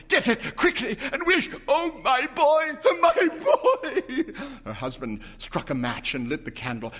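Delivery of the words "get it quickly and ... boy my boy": high, loud, sobbing laugh; wailing plea. A narrator's reading. Mother wailing for her dead son, high and loud. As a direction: break down over my boy.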